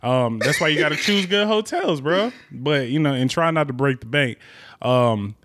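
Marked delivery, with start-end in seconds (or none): none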